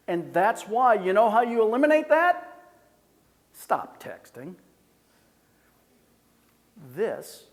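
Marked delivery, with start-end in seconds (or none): none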